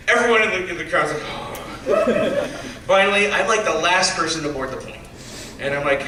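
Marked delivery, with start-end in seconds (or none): 1.3-2.8 a breathy laughing gasp, the crowd shocked; mock crowd gasp. Crowd at the gate watching the ticket throw, laughing gasp. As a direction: gasp like the whole crowd just saw it.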